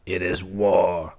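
slow, contained menace; savage retort. A narrator's reading. King accepts the woodsman's war, slow understated savagery. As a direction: return it with restrained savagery, unhurried. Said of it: "returned the king savagely".

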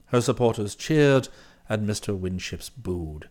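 low, soft, measured valet's report; composed valet Jeeves. Acted. Valet reporting the meeting to his employer, composed and formal. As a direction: report every detail with unruffled formality.

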